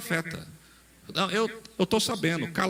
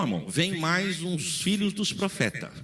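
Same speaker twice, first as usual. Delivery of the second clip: normal talking voice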